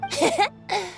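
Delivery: high, light chuckle; pleased giggle. Acted. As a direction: giggle at the compliment. Bard pleased by praise.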